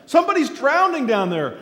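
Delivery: high, loud alarmed pleading; frantic scream. Retold or imitated. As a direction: yell to the crowd to save the man. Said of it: "she begins to scream".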